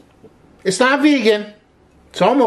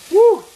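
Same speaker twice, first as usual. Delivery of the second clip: excited whoop; 11 semitones higher, 7 dB louder than usual. Real, not acted. Garlic goes in pan.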